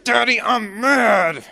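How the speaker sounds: put-on high, loud and slow pouty toddler; little boy voice. Toddler son announcing his anger to daddy, high and pouty. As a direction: play the cross three-year-old stating it. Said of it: "He goes like this".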